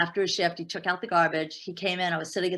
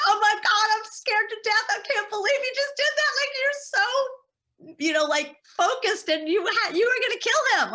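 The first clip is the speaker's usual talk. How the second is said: much higher, awed and excited voice of the manager; excited Romanian manager. Retold or imitated. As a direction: squeal it out in shocked admiration, stretching you're so. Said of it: "my Romanian manager was like".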